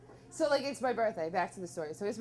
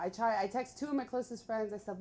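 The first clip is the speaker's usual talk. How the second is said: normal talking voice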